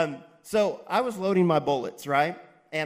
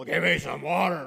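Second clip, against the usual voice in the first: mock yell; 2 semitones higher, 4 dB louder than usual. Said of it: "I'd be yelling at people".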